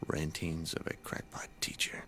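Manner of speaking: bitter, unvoiced whisper. Real, not acted. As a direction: breathe it out in self-mockery. Teacher's bitter self-mockery, whispered.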